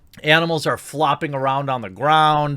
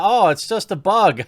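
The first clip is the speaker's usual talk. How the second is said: mock ditzy quote; 6 semitones higher, 4 dB louder than usual. Mocking her dismissive quote.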